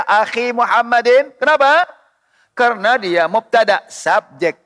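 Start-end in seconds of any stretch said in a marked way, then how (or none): 0.0-1.3 "akhi Muhammadin" with long i ending akhi; raised pitch, stretch the i in "akhi"; ya reading. Shown as a demonstration. Teacher asking why not ya, "akhi" with long i. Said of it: "atau pakai ya".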